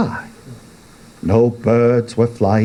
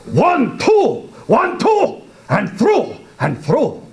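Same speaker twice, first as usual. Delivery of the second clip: much higher, rhythmic battle chant; battle cry. Acted. Hero slashing the Jabberwock, high rhythmic battle cry. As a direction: strike with every word.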